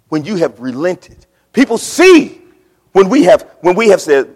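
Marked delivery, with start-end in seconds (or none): 1.5-4.3 loud climax; preaching at full force. Real, not acted. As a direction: let it ring out.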